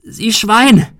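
high, loud angry yell; screamed accusation; furious roar. A narrator's reading. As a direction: hurl the insult at him. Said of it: "brüllte sie".

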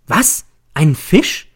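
high, startled yell of disbelief; shouting. Acted. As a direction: jump up and shout it. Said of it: "Ich schnelle empor und schreie". (erschrocken aufschreiend)

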